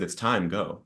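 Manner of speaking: soft, hushed retelling of God's words; a gentle, quiet hint of a whisper; retold whisper. God whispering to a desperate man, softly. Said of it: "he whispered to me".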